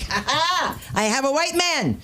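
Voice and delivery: high, gleeful crowing; high mock triumph at the prowler; triumphant cackle. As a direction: crow like you caught a prowler. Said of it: "and I was like".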